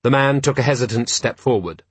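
plain reading voice

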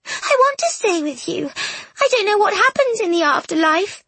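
put-on very high, upset young voice; tearful plea. A narrator's reading. Ghost girl afraid of the afterlife, pleading tearfully. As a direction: plead through tears, pitch right up.

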